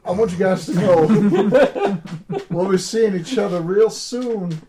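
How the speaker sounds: drawling character cracking up; slow drawl. Acted. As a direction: hold the drawl while trying not to laugh. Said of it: "I'm stuck on slow drawl".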